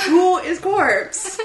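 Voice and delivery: high, slow, laughing question; baffled laugh through the question; amused disbelief. Retold or imitated. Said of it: "And I'm like".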